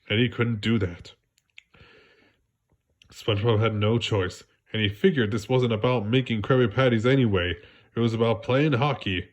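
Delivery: ordinary delivery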